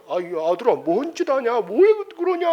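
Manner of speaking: rising to a sharp high startled cry; a flustered mother who can't understand; mother's pitch jumps in alarm; bewildered mother. Retold or imitated. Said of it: "어머니가 막 황당해".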